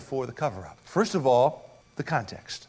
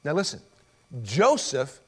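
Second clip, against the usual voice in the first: ordinary talk, nothing marked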